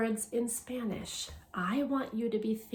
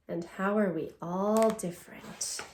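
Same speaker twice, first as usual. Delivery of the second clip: ordinary delivery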